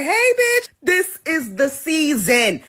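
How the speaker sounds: high, sassy hurricane, slowing down. Pretend. Hurricane Melissa greeting the tourists, high and sassy. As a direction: play the hurricane taunting the tourists. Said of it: "Melissa didn't just pop up out of nowhere like".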